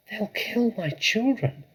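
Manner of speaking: a mother in terror; anguished cry. Acted. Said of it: "cried Missus Fox".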